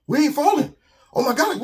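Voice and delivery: thrilled cry; excited realisation. Acted. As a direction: shout it as joy breaks through. Animals realise they float.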